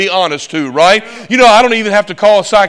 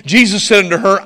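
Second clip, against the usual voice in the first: usual speaking voice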